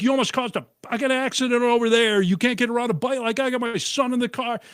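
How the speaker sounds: high, strained angry telling-off; shouting back at the grandma, high angry rant; retold angry rant. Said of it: "I'm like".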